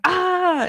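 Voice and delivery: high, mild "ah" yell; American-style yell. Shown as a demonstration. Host showing how Americans yell, high mock yell. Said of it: "people yell differently".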